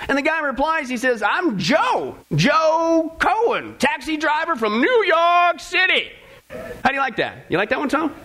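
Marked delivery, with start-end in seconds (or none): 1.2-6.1 hammed-up New York cabbie, higher and drawn out; East Coast accent. Taxi driver announcing himself to Saint Peter, hammed-up East Coast accent. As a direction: attempt a big slow East Coast accent. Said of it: "supposed to be East Coast".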